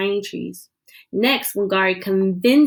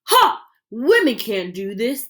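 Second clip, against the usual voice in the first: scornful laugh. Acted. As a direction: scoff at the women. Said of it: "The government men laugh".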